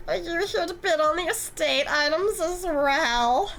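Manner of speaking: a lightly voiced smug, flirty antique dealer; drawn-out, teasing greeting with a slight edge; smug Eric.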